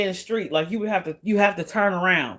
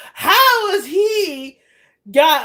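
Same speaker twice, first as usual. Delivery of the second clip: incredulous; 10 semitones higher, much slower than usual. Real, not acted. Outraged rhetorical question.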